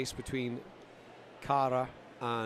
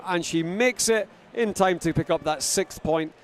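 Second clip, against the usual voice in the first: lively play-by-play, pushing louder; live race commentary; 4 semitones higher, 7 dB louder than usual. Acted. Commentator calling the race to the crowd, lively play-by-play. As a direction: call the ninja race as it happens.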